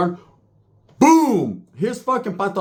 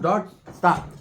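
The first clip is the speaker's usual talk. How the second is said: usual speaking voice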